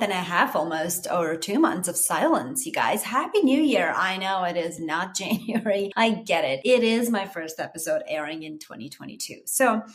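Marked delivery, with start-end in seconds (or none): none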